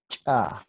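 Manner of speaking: "cha" with CH popped sharply before the vowel; explosive CH. Shown as a demonstration. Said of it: "y la explosiva, explotando".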